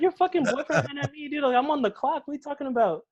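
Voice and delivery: high-pitched, exasperated arguing back; worked-up, incredulous comeback; retold indignant protest. Retells arguing back at the girlfriend.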